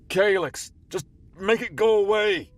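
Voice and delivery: high panicked yell; desperate shout. Acted. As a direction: scream it in despair. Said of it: "He shouted at empty air".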